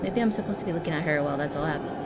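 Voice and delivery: ordinary talk, nothing marked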